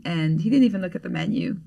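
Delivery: normal talking voice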